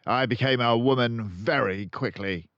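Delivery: loud, high bullying headmistress; blustering headmistress; Trunchbull voice. A narrator's reading. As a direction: brag it like a brute.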